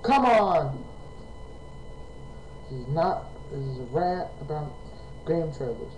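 ordinary talk, nothing marked

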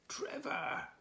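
high-pitched call after a runaway; acted high hail. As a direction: call his name across a distance. Police calling after fleeing Trevor.

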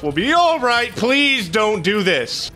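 high, stretched-out plea; panicky pleading. Real, not acted. Player insisting things are fine, then begging, high and strained. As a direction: beg the game to spare you. Pleading with the game mid-fight.

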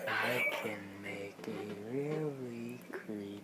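slow low creepy whisper. Shown as a demonstration. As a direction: drag it out, eerie and hushed. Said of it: "Sound like Snake".

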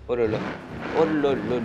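wow-struck exclamations; excited oh là là. Real, not acted. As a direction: gush over the car out loud. Car revealed, gasps at it.

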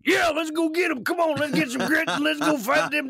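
rally the troops like a trucker; Southern trucker Aquaman. Retold or imitated. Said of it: "Aquaman sounded like".

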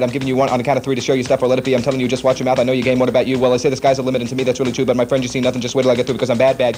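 speed-recited lyrics over music; fast-talking rendition. Shown as a demonstration. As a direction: rattle the Bad lyrics off fast. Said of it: "give us your rendition of it".

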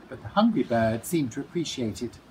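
ordinary delivery, nothing marked

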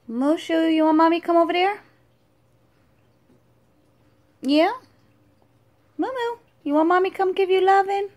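high, fast sing-song cooing at a pet. Real, not acted. Mom coos to her dragon Mushu in high baby talk.

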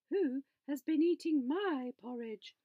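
a lifted, motherly bear voice. A narrator's reading.

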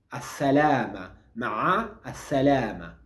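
ma'a as-salama said slowly, piece by piece; slow breakdown. Shown as a demonstration. Teacher breaking down the farewell for learners, slowly.

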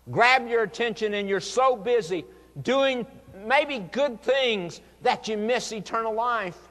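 high, loud pulpit exhortation; impassioned preaching. Acted. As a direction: preach an urgent warning at full voice. Preacher exhorting at full force.

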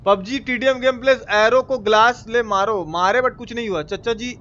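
usual speaking voice

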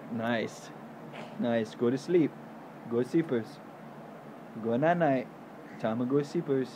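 low soft lulling voice; soothing. Real, not acted. Lulling toddler to sleep.

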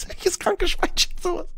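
high breathy whisper through a laugh; giggling whisper. Real, not acted. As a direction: whisper it, cracking up. Laughing aside mid-reading.

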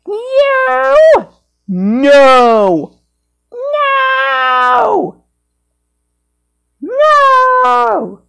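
high shrieked refusals; shrieking no. Acted. Escalating shrieks.

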